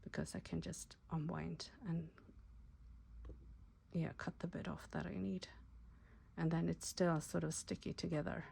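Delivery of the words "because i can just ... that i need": soft whisper while working. Real, not acted. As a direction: whisper it to yourself, half distracted. Muttering to herself while working.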